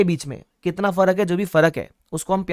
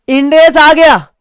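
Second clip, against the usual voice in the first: higher, louder, slow mock lament over the new index; mock dramatic cry; 9 semitones higher, 12 dB louder, slower than usual. Teacher to class, mock dramatic lament. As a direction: ham up the news like a soap drama. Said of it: "sir, ये तो senti बातें कर रहे हो आप".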